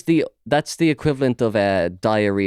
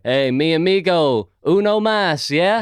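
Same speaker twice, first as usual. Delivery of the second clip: put-on drunk tourist Spanish; 6 semitones higher, slower than usual. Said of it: "they're like".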